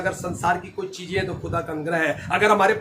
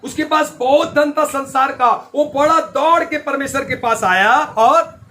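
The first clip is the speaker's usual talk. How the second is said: higher, pressing sermon storytelling; full-force preaching; 10 semitones higher, 9 dB louder than usual. Real, not acted. Preacher telling believers of the rich man running, forceful preaching. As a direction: drive the story home to the crowd.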